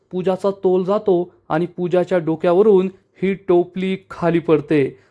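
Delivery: usual speaking voice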